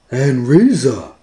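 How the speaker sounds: slow, high, loud dog talk; Scooby-Doo voice. Acted. Scooby wants pizza, slow high dog voice. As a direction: drag it out like Scooby.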